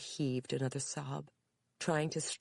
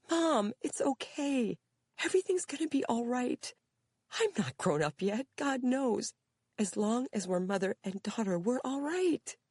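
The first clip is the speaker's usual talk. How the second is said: tearful comfort; 11 semitones higher, 4 dB louder than usual. A narrator's reading. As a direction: comfort her while crying. Daughter comforts through tears.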